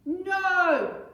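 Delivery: high, loud defiant yell; stubborn child's shout; boy bellowing. Acted. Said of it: "bellowed Henry".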